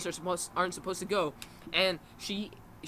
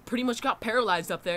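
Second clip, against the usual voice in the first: ordinary talk, nothing marked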